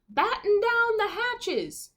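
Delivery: high, slow shouted order; captain shouting. Acted. Little captain shouting orders at his crew, high and slow. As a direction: bark the order like a tiny captain. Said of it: "Captain Jack shouted".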